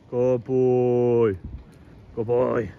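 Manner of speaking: gentle praise. Real, not acted. Praising the dog after it sits.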